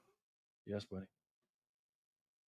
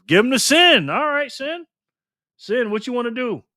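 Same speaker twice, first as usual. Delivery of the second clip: higher, lively call-out; raised voice; 8 semitones higher than usual. Real, not acted. Host calls Sin up to play, higher and lively. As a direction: call the next player up. Calling on player five.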